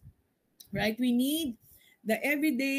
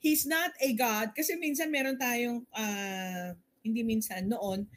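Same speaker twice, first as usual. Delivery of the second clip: ordinary talk, nothing marked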